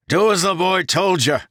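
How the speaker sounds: high, loud strained order; ragged yell. Acted. Ten yelling to the crew, ragged shout. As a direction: yell it hoarse with effort. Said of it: "his voice ragged".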